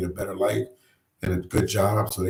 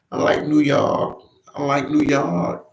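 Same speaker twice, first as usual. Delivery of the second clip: slow, higher mock migrant voice saying he loves the city; imitating migrants; 7 semitones higher, slower than usual. Streamer voicing stubborn migrants to his chat, slow and higher. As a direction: stretch it out in a higher, lightly put-on voice. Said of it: "They like New York".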